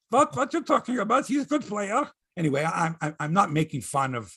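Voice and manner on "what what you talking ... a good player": put-on higher, Eastern European Schmengi accent; Stan Schmengi accent. Mocking the goalie's interview, higher Schmengi-style accent. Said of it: "he sounds like ... Stan Schmengi".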